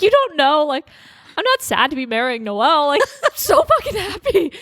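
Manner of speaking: retold emotional insistence, laughing through it; mock exasperated protest. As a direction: protest it playfully, climbing in pitch. Retold protest to guests while crying.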